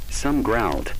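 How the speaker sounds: ordinary delivery, nothing marked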